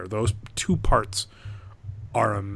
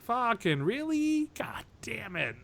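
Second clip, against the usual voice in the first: high, drawn-out groaning disbelief; slow, high-pitched letdown; exasperated disappointment; 11 semitones higher, 3 dB quieter, slower than usual. Retold or imitated. Viewer let down by cliche science fiction movie, exasperated. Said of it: "and I'm like".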